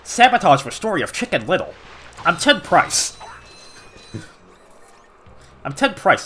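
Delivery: lightly voiced impression of a game executive; mock introduction in a put-on boss voice; Ted Price. Pissed-off player playing the studio boss, lightly put-on voice. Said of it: "Just pull out the Ted Price voice".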